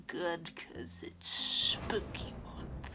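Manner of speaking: slow spooky whisper. Pretend. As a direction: hush it like a secret. Spooky month whispered intro.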